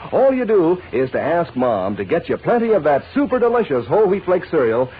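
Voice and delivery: usual speaking voice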